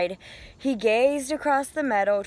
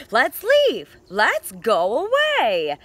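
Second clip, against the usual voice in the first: louder, higher, eager call to leave; excited Duck; 3 semitones higher, 7 dB louder, slower than usual. Acted. Duck urges Goose to leave, eager and loud. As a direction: sell the trip with big enthusiasm.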